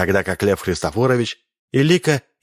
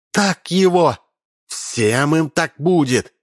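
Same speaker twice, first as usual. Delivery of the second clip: high, slow cheering yell; gleeful cry; 8 semitones higher, slower than usual. A narrator's reading. Lika cheering the slap at the screen, high gleeful yell. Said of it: "женщина Лика закричала".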